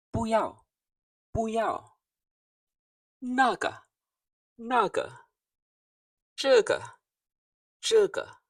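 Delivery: 不要, 那个, 这个 each said high and dropping sharply; fourth tones. Shown as a demonstration. Teacher to class, showing sharp fourth tones. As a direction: drop each word hard from a high start. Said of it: "So those are all fourth tones".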